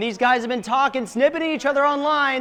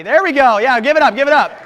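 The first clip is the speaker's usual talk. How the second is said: loud call to cheer; hyping the crowd; 10 dB louder, faster than usual. Real, not acted. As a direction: pump up the arena. Firing up the live crowd.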